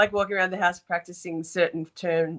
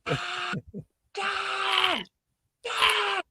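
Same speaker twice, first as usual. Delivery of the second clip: mock barking yell; 12 semitones higher, 3 dB quieter, much slower than usual. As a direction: bark it out like a witness on the stand. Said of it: "That gave me nightmares".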